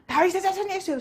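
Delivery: high, strained call for help; crying out. Retold or imitated. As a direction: call out urgently to someone out of sight. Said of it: "소리를 질렀습니다".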